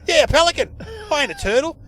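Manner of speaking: high, loud, excitable talkback caller; caller impression. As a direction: pitch it up like an over-keen radio caller. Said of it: "he sounded like one of your code".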